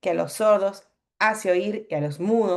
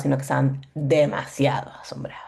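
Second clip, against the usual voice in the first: secretive whisper stressing demasiado; whispered emphasis; 5 semitones lower than usual. Real, not acted. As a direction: drop to a whisper on demasiado asombrados. Stressing how amazed they were.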